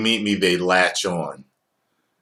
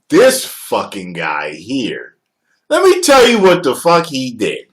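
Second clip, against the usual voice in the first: mock complaining rant; 3 semitones higher, 6 dB louder than usual. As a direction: grumble about this guy, then blow up. Someone's talking about you.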